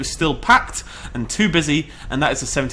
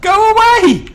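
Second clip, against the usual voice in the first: much higher and louder battle shouts; fighting yell; 5 dB louder, much slower than usual. Real, not acted. Fighting off a T-Rex, loud high battle shouts. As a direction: yell him off with everything you've got.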